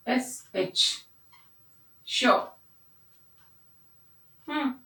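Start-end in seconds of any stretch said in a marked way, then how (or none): 0.1-2.2 "श" said as a long hissing sh, tongue pushed up; sh sound demo. Shown as a demonstration. Teacher demonstrating "श" to the class, drawn out. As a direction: say "श" slowly with breath pushed out. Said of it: "इसका उच्चारण कैसे जाता है".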